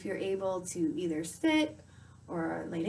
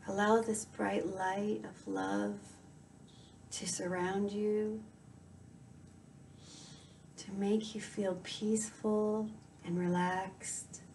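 guided meditation; 9 dB quieter than usual. Acted. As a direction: softly guide the listener into calm, unhurried.

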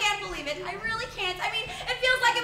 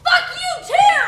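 loud, higher snap; angry shout; 10 semitones higher, 9 dB louder, slower than usual. Acted. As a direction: hurl it at him. Angry outburst at partner.

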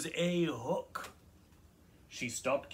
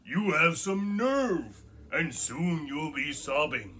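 lightly voiced grumpy troll, slow threat; 3 semitones higher, 6 dB louder than usual. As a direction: menace the little goat, slowly.

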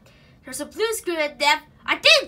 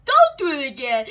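usual speaking voice

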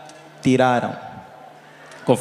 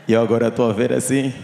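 ordinary delivery

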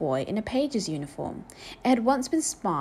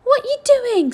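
very high, loud outcry; loud, appalled old woman; shocked scolding; 11 dB louder, slower than usual. A narrator's reading. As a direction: cry out in shock at the child. Joan scolds boy guzzling from bowl.

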